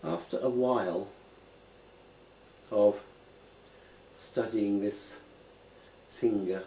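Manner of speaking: unhurried meditation guide; guided contemplation. Acted. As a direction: lead them gently into looking closely. Guides a slow finger contemplation.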